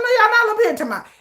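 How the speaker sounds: quick high indignant comeback; fast high retort. Retold or imitated. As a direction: fire back fast and high. Retorting to blamers.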